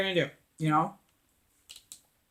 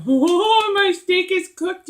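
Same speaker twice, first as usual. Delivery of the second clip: dismayed surprise; 10 semitones higher, 5 dB louder, slower than usual. Retold or imitated.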